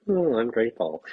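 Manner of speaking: mock shallow gratitude. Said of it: "and not just like".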